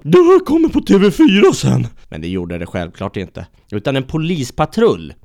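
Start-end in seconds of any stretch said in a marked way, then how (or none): 0.0-1.9 very high, loud squeaky boast; squeaky Kurt. Acted. Kurt boasting to the man, high and loud. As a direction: crow it like a cartoon loser.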